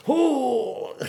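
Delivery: very high, loud mock gasp; mock shocked gasp. Listener shocked at being called goyim, high loud mock gasp. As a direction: gasp like you heard a slur. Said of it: "they'd be like".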